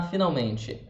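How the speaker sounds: normal talking voice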